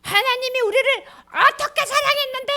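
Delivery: high, loud indignant whine; angry retort. Acted. Angry people retorting to God, indignant whine. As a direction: snap back indignantly. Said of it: "화가 났어".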